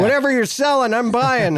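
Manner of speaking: high-pitched, enthused talk-show host impression; hammed-up showbiz enthusiasm; Letterman. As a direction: mimic a host won over on air. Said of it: "then to see Letterman go".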